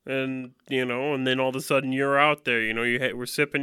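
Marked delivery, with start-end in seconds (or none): none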